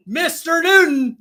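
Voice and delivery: high-pitched announcer call; game-show reveal, pitched well up; winner announcement. Real, not acted. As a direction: sing out the name as the next winner. Host announces next tied winner.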